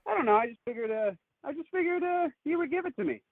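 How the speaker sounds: high-pitched imitation of an airy excuse; clueless date. Date explaining how she'd buy without money, mock clueless.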